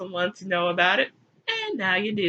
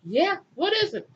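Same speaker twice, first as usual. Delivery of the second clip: high, innocent question; hammed-up naive voice; naive Abel; 9 semitones higher than usual. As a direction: ask brightly, unsuspecting. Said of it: "Abel was like".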